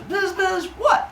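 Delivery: very high, squeaky bug voice; buzz, then question as a tiny insect; put-on gnat squeak. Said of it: "And she goes".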